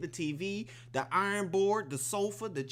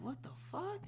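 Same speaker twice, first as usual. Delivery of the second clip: high, stunned what the fuck; shocked reaction; 12 semitones higher, 10 dB quieter than usual. Retold or imitated. Viewer reacting to the movie scene, stunned. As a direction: squeak it out in disbelief. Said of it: "You would be like".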